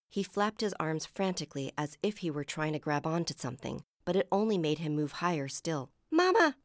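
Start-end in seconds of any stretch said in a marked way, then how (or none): 6.1-6.6 high, loud cry for mother; frightened cry. A narrator's reading. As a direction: cry out in alarm. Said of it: "he cried".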